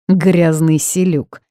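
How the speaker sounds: ordinary delivery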